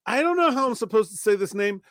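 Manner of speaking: ordinary talk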